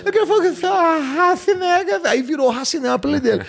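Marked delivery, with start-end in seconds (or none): 0.0-1.8 nervous stutterer. Retold or imitated. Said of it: "ficar mais nervoso".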